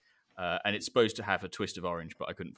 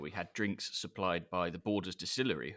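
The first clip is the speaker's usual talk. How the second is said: normal talking voice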